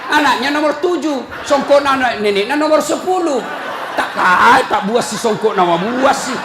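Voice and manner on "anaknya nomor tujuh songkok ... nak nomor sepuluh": usual speaking voice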